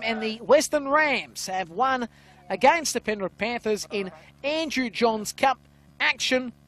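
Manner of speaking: usual speaking voice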